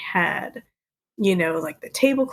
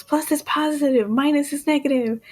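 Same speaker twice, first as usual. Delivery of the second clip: Woody yelling; 7 semitones higher than usual. Retold or imitated. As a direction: call out a hurried instruction in imitation. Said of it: "Woody yells".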